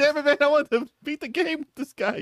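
mock dismay. Voicing a disappointed Battlefield player.

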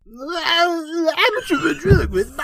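high strained comic screech, laughing; Bobcat Goldthwait voice. Shown as a demonstration. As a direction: squeal it out, cracking up. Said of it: "the Bobcat Goldthwait voice is coming back".

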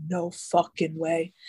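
slow, drawn-out mock disbelief; lightly imitated stunned "no way". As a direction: let each word sink in, retelling the shock. Seeing the stalker at her door.

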